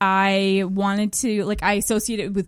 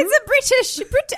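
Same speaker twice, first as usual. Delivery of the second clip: put-on high-pitched go at a British accent; badly faked British accent; attempted British accent; 12 semitones higher, 3 dB louder than usual. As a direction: try a British accent and give up. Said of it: "I can't do the accent".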